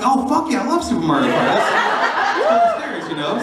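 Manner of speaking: laughing, high-pitched eager reply. Retold or imitated. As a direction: gush with excitement while cracking up. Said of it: "I'm like".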